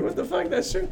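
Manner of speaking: high, soft squeal of fake shock; mock disbelief. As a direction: play stunned, high and hushed. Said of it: "I'm like".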